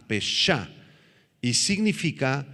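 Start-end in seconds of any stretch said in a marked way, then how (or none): 0.1-0.7 peshá, accented on the last syllable; stress on final A. Shown as a demonstration. Preacher repeats the Hebrew word for listeners, final A of peshá stressed. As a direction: land firmly on the final A of peshá. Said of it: "con acento en la A".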